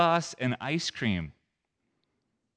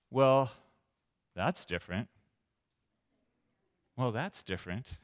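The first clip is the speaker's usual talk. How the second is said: polite disapproval. Acted. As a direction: politely hold back what you really think. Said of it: "It's a more positive ... a way of saying".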